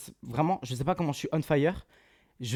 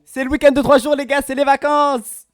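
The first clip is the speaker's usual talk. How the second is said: much higher and louder holiday cheer; excited shout; 13 semitones higher, 15 dB louder than usual. Real, not acted. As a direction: yell it like the holidays just began. Hypes chat about weekend.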